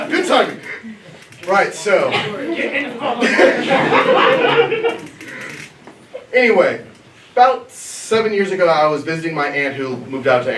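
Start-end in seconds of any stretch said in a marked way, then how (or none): 2.2-5.6 long high real laugh; can't hold the laughter in. Real, not acted. Still laughing before the story begins.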